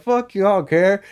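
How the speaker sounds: high, loud bravado; re-enacted cocky comeback; defiant trash talk. Acts defiant trash talk back.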